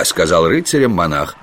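ordinary delivery

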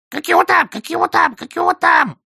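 very high, loud repeated squawk; frantic high bird cries; shrieking parrot. A narrator's reading. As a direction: screech it like an agitated parrot. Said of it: "завопил ему в ухо попугай".

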